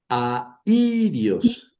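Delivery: "airios" with A and a long stressed I held apart; separated syllables. Shown as a demonstration. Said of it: "en sílabas separadas".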